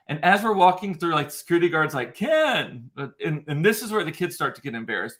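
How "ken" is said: calling out. Retold or imitated. Security guard calling out.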